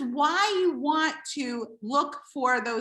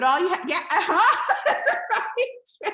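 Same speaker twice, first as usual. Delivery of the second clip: laughs through it, higher; real laughter breaking in; laughing; 3 semitones higher than usual. Real, not acted. Reading viewers' comments while laughing.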